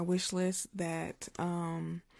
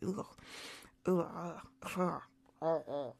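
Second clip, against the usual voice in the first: mock retching "ugh"; disgusted gagging; 3 semitones lower, 6 dB quieter, much slower than usual. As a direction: recoil with low, repeated noises of disgust. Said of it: "every time I think about soft spoken".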